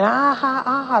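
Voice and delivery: imitated mocking laugh. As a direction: mimic a girl laughing and pointing.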